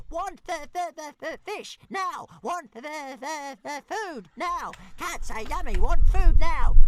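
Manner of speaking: very high stuttering tantrum, loudest at the end; shouting baby dragon. A narrator's reading. Struggling Toothless held by the tail, high wild demands. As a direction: squeal each demand like a frantic little creature. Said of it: "The dragon struggled wildly, shouting".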